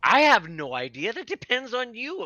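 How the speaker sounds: exasperated retelling. Retold or imitated. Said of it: "I'm like".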